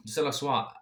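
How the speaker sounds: plain reading voice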